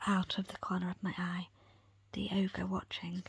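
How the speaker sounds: ordinary delivery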